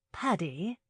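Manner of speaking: ordinary delivery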